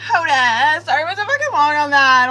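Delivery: laughing loudly while apologizing. Real, not acted. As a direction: burst out laughing mid-sentence. Laughing at own long reading.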